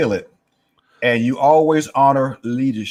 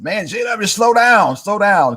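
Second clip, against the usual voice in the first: high, loud teasing call to slow down; calling out to teammate; 7 semitones higher, 3 dB louder than usual. Retold or imitated. Track teammates calling out to the runner, a loud teasing call. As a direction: shout it across the track at a fast teammate.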